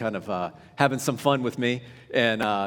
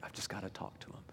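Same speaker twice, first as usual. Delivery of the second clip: very soft, low confession; hushed voicing of a private urge; whispered inner thought; 4 semitones lower, 18 dB quieter, faster than usual. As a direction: whisper it as if to yourself. Quoting his own inner urge.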